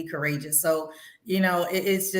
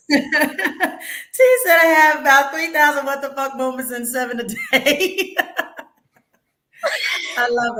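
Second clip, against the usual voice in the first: louder, laughing through the words; can't stop laughing while quoting; 4 semitones higher, 6 dB louder, slower than usual. Real, not acted. Host laughing as she quotes a guest's joke, laughing through it.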